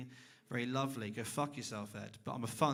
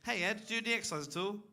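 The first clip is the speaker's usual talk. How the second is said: mock interviewer; 5 semitones higher, faster than usual. Shown as a demonstration. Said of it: "Here we go".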